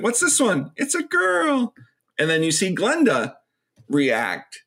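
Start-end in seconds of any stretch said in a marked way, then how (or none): 0.0-1.7 high-pitched quoted exchange; mock Chucky and doctor. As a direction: squeak the question and answer. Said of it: "Chucky's like".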